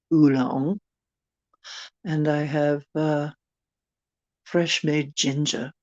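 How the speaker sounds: put-on genteel British tea-party accent. Inviting a darling over for tea, British accent. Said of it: "I can do a few accents".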